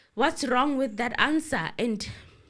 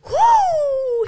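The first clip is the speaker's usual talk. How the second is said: laughing whoop; 12 dB louder than usual. Real, not acted. Laughing at the courtroom exchange.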